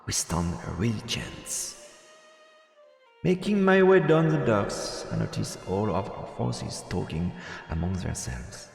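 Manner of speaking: ordinary delivery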